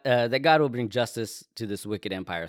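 ordinary talk, nothing marked

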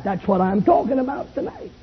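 ordinary talk

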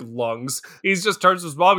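ordinary delivery

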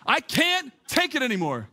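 high, hammed-up cry of exasperation; mock breakdown, pitched high; exasperated outburst. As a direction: act out being at your wits' end. Repeats his own exasperated outburst.

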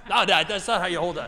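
loud, high-pitched correction; exasperated kid. Retold or imitated. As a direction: act out a son yelling at his dad over a job. Retold kid correcting his dad.

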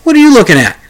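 high, loud accusing challenge; loud demand. Retold or imitated. Grandmother confronting the host at the table, high and loud. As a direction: call him out across the table. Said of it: "says to me loudly".

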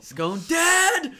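high, loud joking shout; shouted punchline. Acted. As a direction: yell it as the punchline. Repeats the joke, stabbing.

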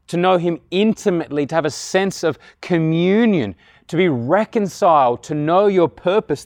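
high, loud sermon delivery with punched key words; impassioned preaching. Real, not acted. Preacher proclaiming God's message of salvation to the congregation, impassioned. Preacher rises to full force.

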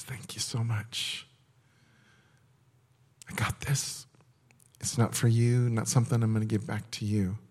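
whispered comfort in God's voice; whispered Father's reply. Acted. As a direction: whisper it like holding a child close.

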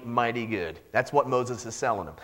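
ordinary delivery

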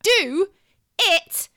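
yelling at full volume, high and slow at first; furious shout. Retold or imitated. Mother screaming at constipated toddler on Christmas Eve, furious shout. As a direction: lose it and scream at the child. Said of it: "I shouted".

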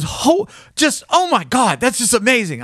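high, overwhelmed amazement; excited. Retold or imitated. Ring's owner told he gets it back, excited. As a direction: gush in happy disbelief. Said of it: "this guy's just exci".

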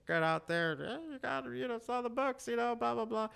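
high, put-on sales-pitch patter; Ray Romano. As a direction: imitate the friend's high sitcom voice. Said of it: "He's always like".

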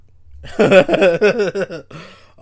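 long, high, loud giggling laugh; real burst of laughter at oneself; hearty laugh. Real, not acted. Laughing before recalling firings.

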